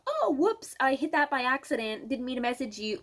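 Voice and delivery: high, bright oops voice; mock apologetic buyer. Buyer apologizing for an accidental message, high mock oops. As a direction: play the embarrassed buyer.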